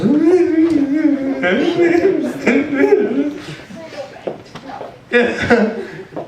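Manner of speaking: long high-pitched laugh. Real, not acted. Laughs retelling his mess.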